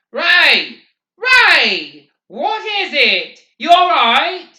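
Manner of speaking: high, very loud urgent shouts; screaming. A narrator's reading. McVries to Garrity over the crowd's roar, screaming. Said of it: "screaming at him".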